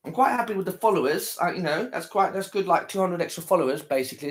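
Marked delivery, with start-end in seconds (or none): none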